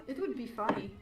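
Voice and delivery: ordinary talk, nothing marked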